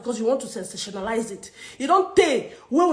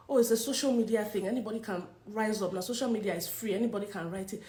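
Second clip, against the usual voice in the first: quiet disgust; 5 semitones lower, 9 dB quieter than usual. Real, not acted. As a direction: say it low, drained and disgusted.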